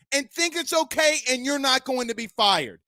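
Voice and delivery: ordinary delivery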